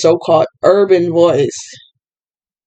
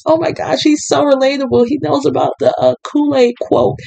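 higher-pitched mock swoon; mock gushing voter; 6 semitones higher than usual. Mocking voters falling for a candidate's slang, fake gushing. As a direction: gush like a won-over fan, sarcastically.

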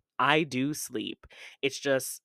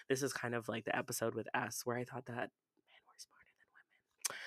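unvoiced confiding whisper; whispered aside; 11 dB quieter than usual. Real, not acted. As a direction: whisper it like a secret.

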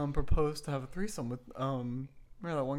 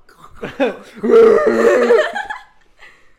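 loud burst of laughter; 8 semitones higher, 23 dB louder than usual. Real, not acted. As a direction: crack up loudly. Big laugh.

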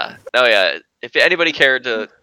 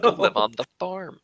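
high, sing-song lilt; mock-sweet voice, pitched well up; mock cheerful line; 9 semitones higher than usual. Friend teasing about being sent to the farm, in a high mock-sweet lilt.

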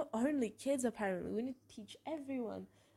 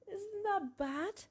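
small high voice, drawn out slowly; imitated tearful question; 11 semitones higher, much slower than usual. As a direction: imitate a friend asking through tears, without crying. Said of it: "crying so bad".

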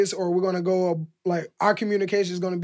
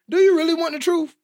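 higher-pitched mock question; raised, questioning voice for a quote; imagined challenge; 9 semitones higher than usual. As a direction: pitch it up like you're asking someone warily.

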